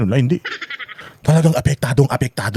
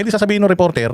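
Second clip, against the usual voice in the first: ordinary talk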